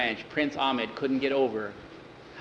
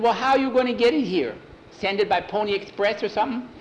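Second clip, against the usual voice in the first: put-on much higher, excited kid-like voice; eager young cowhand; 9 semitones higher, faster than usual. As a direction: pipe up high and eager like a boy. Skeeter teases with a laugh.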